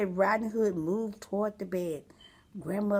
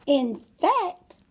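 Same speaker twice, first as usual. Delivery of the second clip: higher, louder sly wolf; eager wolf; 8 semitones higher, 6 dB louder than usual. Acted. Wolf tempts the girl, eager and sly. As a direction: pounce on the idea. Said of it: "the wolf said".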